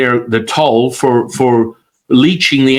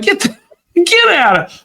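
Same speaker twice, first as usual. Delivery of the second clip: much higher squeaky disbelief; stunned disbelief. Retold or imitated. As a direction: blurt it high in shock. Disbelief at the healing.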